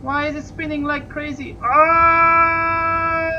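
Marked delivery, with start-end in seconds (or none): 1.6-3.3 long, loud, high scream; real scream. Real, not acted. Pilot screams as his ship spins wildly, real loud scream. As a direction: let out a full scream of real fright. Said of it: "Sorry for the scream".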